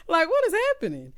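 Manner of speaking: high, loud, incredulous question; amused disbelief. Real, not acted. Baffled by the show's country boy, loud amused disbelief. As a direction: blurt it out high after laughing.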